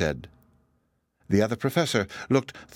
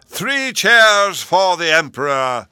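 full, high, drawn-out shout; rallying shout; 9 semitones higher, 9 dB louder, much slower than usual. A narrator's reading. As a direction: bellow it slowly to rouse the hall. Chancellor calls for cheers.

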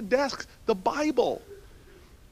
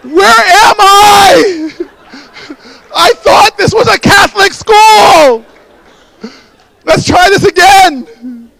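high, slow, louder disbelief; mock bewildered shout; 8 semitones higher, 19 dB louder, slower than usual. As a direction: bellow the fake confusion. Mock bewilderment at quiet crowd.

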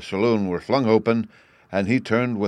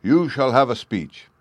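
raised, louder public announcement; prompt, obliging; 4 semitones higher, 5 dB louder than usual. A narrator's reading. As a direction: grant it promptly to the crowd. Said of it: "replied with extraordinary promptitude".

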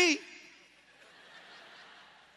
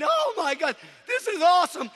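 raised, louder retold amazement; overwhelmed joy; 5 semitones higher, 5 dB louder than usual. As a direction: re-enact being floored by joy. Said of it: "I just weep going".

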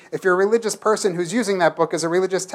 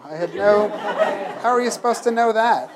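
laughing; 4 semitones higher than usual. Retold or imitated. Retelling own embarrassed reply.

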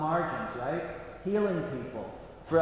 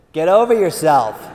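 louder, playful scolding; 10 dB louder than usual. Retold or imitated. God teasing a vain priest, playful and louder. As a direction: tease him to get over himself. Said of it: "in a playful way".

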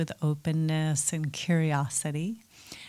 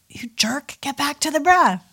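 high, loud, harsh snap at yourself; scolding self-shout; 9 semitones higher, 6 dB louder than usual. Acted. Meditator scolding themselves when attention wanders, angry shout. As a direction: berate yourself sharply, pitch jumping up. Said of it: "then you shout to yourself".

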